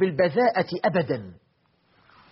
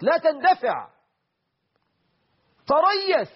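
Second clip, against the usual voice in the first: rising, urgent plea to hold back; preacher calling out to the crowd to slow down; urgent high call; 12 semitones higher than usual. Real, not acted. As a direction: cry out the warning, pitched high. Preacher urging restraint.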